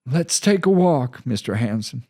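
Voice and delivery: steady reassuring commander; calm commander. Acted. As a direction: soothe the angry reporter. Commander invites walk.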